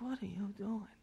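stunned under the breath; disbelief. Retold or imitated. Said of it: "I was like".

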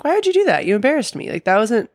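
high, pleading hurt complaint; mock-hurt high voice; hurt Emily. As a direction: whine it up high like she's wounded.

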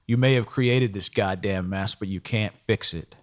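ordinary delivery, nothing marked

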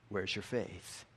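usual speaking voice

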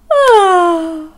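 a big sleepy bear sigh; tired sigh. Acted.

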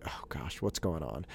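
low, very soft aside to himself; weary mutter. Retold or imitated. Annoyed dad finding his son in tears, weary mutter. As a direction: sigh it out quietly, worn down.

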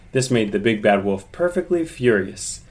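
usual speaking voice

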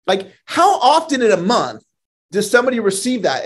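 usual speaking voice

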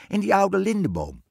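high, loud witch voice; emphatic storybook witch; bossy witch. A narrator's reading. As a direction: direct them sharply in a piercing witch voice. Witch points queen to the tree.

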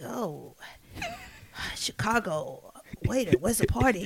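husky, sighing, slow imitation; soft, weary mock rasp. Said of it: "This is how he talks".

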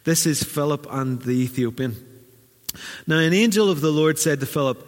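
normal talking voice

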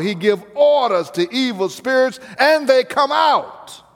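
astonished townspeople crying out; amazed crowd. Acted. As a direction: let the wonder burst out.

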